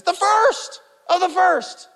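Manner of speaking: slow and high, "first" hit; extra emphasis. Shown as a demonstration. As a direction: drive home "The first of the first". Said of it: "extra emphasis".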